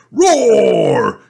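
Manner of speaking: high, loud roar; toy roar. Acted. Toy dinosaur waking in the night, loud high roar. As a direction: roar out of the dark.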